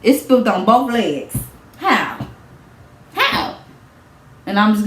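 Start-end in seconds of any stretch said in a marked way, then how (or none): 0.0-3.6 imitating judge. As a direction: grill her like a skeptical judge. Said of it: "They gonna be like".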